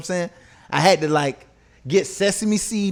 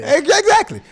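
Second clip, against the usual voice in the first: laughing; 13 semitones higher, 4 dB louder than usual. Real, not acted. Laughing at co-host's joke.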